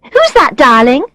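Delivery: high-pitched, carrying question; loud voice. A narrator's reading. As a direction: ask your darling so others can hear. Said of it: "Tanya asks in a loud voice".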